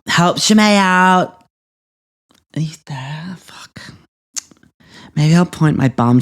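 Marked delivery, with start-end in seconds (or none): 2.5-3.9 soft unvoiced whisper into the laundry; whispered call. Real, not acted. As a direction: call softly as if not to scare him.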